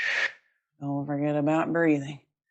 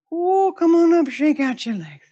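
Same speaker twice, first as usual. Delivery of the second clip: relieved release; 12 semitones higher, 8 dB louder than usual. Real, not acted. Relief after the hard hold.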